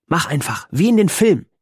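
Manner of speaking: a girl trying to sound serious, playing a film scene; a hint of a serious girl. A narrator's reading. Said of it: "Ihre Stimme klang ernst". (leicht gespielte Mädchenstimme, am Ende höher und lauter)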